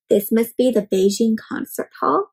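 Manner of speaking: ordinary delivery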